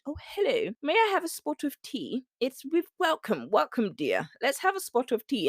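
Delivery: high, hammed-up English tea-party accent; mock Queen's English. Coach imitates Americans doing English accents, mock Queen's English. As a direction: imitate an over-polite Queen's English hostess. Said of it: "they all do the Queen's English".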